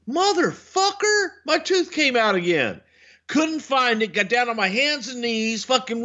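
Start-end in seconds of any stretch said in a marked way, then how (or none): 0.1-2.8 higher exasperated mock cry; replaying the moment the tooth fell out; retold outburst. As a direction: fling out the curse in disbelief. Retelling outburst at home.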